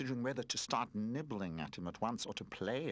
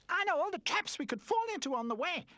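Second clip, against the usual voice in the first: proud adventurer; 5 dB louder than usual. A narrator's reading. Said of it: "Charlie Chip was flattered".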